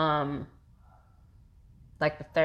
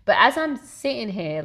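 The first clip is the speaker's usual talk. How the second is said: ordinary talk, nothing marked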